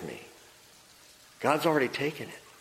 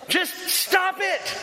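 slow, high exasperated yell; fed-up therapist yelling in her face; retold yell; much slower than usual. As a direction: drag it out as a high holler. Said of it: "he just yells in her face".

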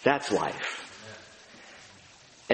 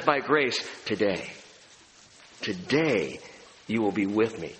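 tender promise; 5 semitones lower, 3 dB quieter than usual. Retold or imitated. Jesus promising the thief.

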